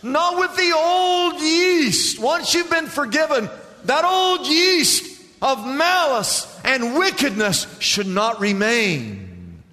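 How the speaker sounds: preaching emphasis. Real, not acted.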